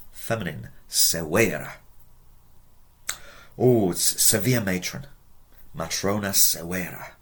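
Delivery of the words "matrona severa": ordinary talk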